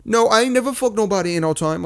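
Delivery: high mock-indignant British girl. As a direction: imitate a girl protesting in a British accent. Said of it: "or like the girl will be".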